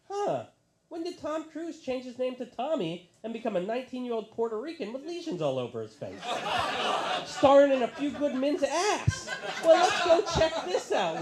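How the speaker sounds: puzzled tourist peering through a monocle; old lady voice. Retold or imitated. Old Alabama lady couple.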